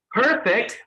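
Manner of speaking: "perfect" with an American hard, airy P; American accent. Shown as a demonstration. Trainer has the class feel air on fingers, American accent "perfect". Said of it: "say it like an American".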